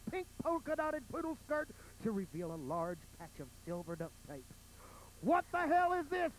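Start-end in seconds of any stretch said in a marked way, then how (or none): 5.2-6.3 higher, louder angry yell. Acted. Biker finds duct tape, yells in angry shock. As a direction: bark it out in shock. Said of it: "yelled the hateful bulldagger".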